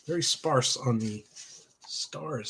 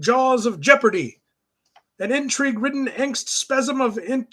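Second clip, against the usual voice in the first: higher, hammed-up cartoon hero voices; cartoon space heroes; 11 semitones higher, 7 dB louder than usual. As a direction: ham up the heroic banter, pitched high. Voices comic characters' dialogue.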